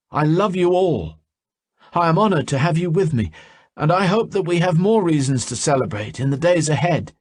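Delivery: lightly voiced speaker at a party toast; a hint of a character giving a toast; toast speech. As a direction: raise a glass and address friends fondly.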